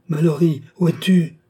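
frantic call for the lost lover; desperate cry. Acted. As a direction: cry his name into the dark. Woman calls her dead lover.

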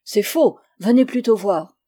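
loud, agitated challenge; agitated retort. A narrator's reading. Pensioner contradicts his doctor, agitated and loud. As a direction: cut in loudly, insisting.

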